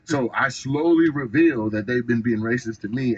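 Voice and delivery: normal talking voice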